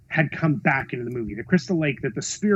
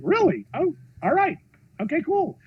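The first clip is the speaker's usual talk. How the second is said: high-pitched, pleasantly taken aback; retold pleasant surprise; 12 semitones higher than usual. Director told the campaign can continue, retold pleasant surprise. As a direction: replay happy disbelief, high and light.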